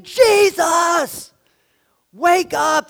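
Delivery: high, loud cry over the storm; desperate shout. Retold or imitated. Disciples shouting to sleeping Jesus over storm, loud desperate cry. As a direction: yell over the gale to wake him. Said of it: "they're saying".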